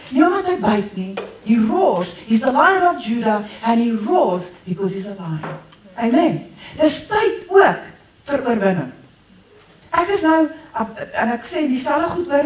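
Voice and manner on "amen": normal talking voice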